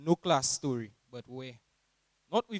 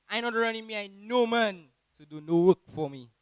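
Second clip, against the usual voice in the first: imitating teacher; 6 semitones higher, 8 dB louder than usual. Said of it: "Mister Saint Rose would say".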